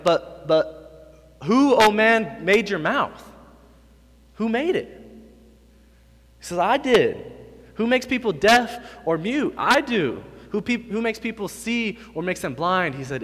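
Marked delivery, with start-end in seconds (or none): 0.0-4.9 high, loud indignant outburst; God snapping back. Acted. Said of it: "he kinda snaps back a little bit". 6.6-13.0 high, loud rebuking questions; God snapping back. Acted. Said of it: "He snaps back at it".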